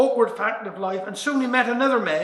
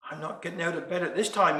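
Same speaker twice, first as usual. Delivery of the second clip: grumbling thought; 4 semitones lower, 7 dB quieter, faster than usual. Acted.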